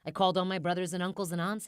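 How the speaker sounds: ordinary delivery, nothing marked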